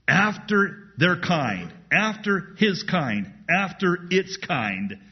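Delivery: preacher driving the point home, slow and emphatic; emphatic repetition. Shown as a demonstration. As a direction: hammer each "kind" phrase home slowly. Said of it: "The language here in the Hebrew is emphatic".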